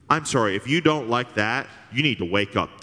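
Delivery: ordinary delivery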